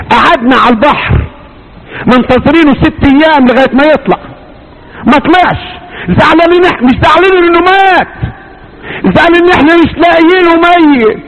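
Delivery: climbing to a high, ringing preacher's voice; full-force preaching. Acted. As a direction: build the grief up high for the crowd. Preaching about drowned brother.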